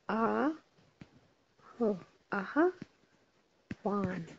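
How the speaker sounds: very drawn-out dictation; slow spelling of आह्वान. Shown as a demonstration. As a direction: stretch the word for spelling. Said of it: "इसकी हम spellings करते हैं".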